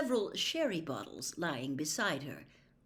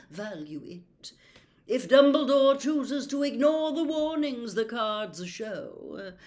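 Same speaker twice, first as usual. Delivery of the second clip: louder, grand and ominous; haughty prophetess; 7 semitones higher, 8 dB louder than usual. A narrator's reading. Trelawney warning Harry about Dumbledore, haughty. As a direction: warn like a wounded oracle.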